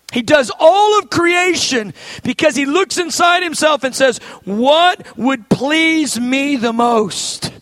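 loud, soaring preacher cadence; full-force preaching. Real, not acted. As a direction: build it up, preach with fire.

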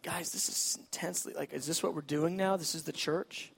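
a low, unvoiced gripe; hushed grumbling. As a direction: mutter it like a bored disciple. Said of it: "They're probably going like".

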